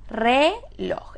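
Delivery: "Reloj" split into re and loj; syllable by syllable. Shown as a demonstration. Teacher asking where the accent falls, syllable by syllable. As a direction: say "Reloj" as two separate syllables. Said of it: "¿Es reloj o es reloj?".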